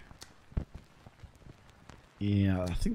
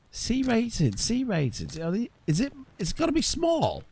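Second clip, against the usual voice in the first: much higher, pleading, quick puzzled questions; baffled exasperation; 13 semitones higher than usual. Real, not acted. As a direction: argue with the screen in disbelief. Puzzling over car class rules.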